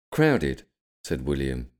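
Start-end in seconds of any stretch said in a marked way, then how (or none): 0.1-0.6 realistic. A narrator's reading. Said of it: "trying to be realistic".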